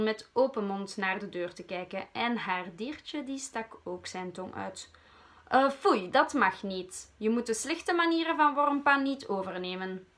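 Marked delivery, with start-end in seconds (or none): 5.5-10.1 loud, earnest telling-off; serious scolding. Acted. Owner scolds her pet for sticking tongue out, serious scolding. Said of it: "zei Ik weet het al ernstig".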